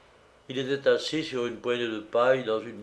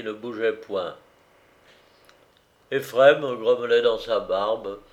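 plain reading voice

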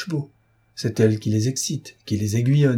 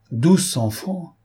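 high-pitched, slow, affronted echo; scandalised outcry, stretching each word; indignant exclamation; 6 semitones higher, much slower than usual. A narrator's reading. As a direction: repeat it in indignant disbelief, drawn out. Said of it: "S'écria le grand homme d'un air indigné".

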